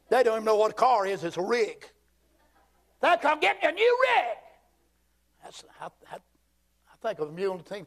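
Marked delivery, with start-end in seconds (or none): 3.0-4.4 high, hammed-up hillbilly holler; mimicked country talk. Preacher imitating Robbinsville folk on cars, hammed-up country holler.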